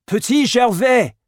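high, full-voiced call; calling out loudly. A narrator's reading. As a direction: shout the name down the path. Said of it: "called as loudly as he could".

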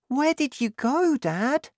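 put-on very high, questioning child; high-pitched childlike question; boy's voice. A narrator's reading. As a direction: ask Dad as a small boy. Boy questions his father.

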